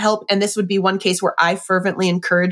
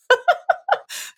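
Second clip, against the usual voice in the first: high squealing laugh of delight. Real, not acted. Laughs at quoted author's line.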